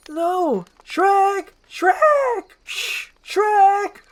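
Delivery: high, loud cries for help; frantic shrieked name; panicked screaming. Acted. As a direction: scream for a friend to save you.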